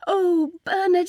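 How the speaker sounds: high, slow swooning cry; Ethel's rapturous voice. A narrator's reading. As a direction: draw the name out blissfully, pitched high. Ethel overjoyed before fainting.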